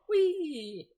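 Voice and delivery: high, loud, drawn-out "whee"; playful whee. Pretend. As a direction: let out a mock-gleeful squeal. Playful squeal after serious aside.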